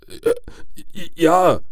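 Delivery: falter, then force out the answer; frightened stammer. A narrator's reading. Father answers the threatening caller, frightened stammer.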